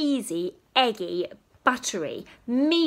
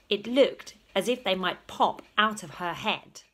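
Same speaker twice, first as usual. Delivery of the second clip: usual speaking voice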